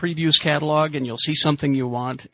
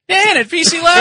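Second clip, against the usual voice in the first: high, loud announcer-style call; sponsor shout-out; 13 semitones higher, 8 dB louder than usual. Acted. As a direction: holler the sponsor's name like a hype man.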